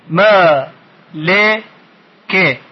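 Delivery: "ما، ل، که" as three separate beats; syllable by syllable. Shown as a demonstration. Teacher recapping the parts for pupils, syllable by syllable. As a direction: hold "ما" long, keep "ل" and "که" short. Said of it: "این رو خودتون بخش کنید".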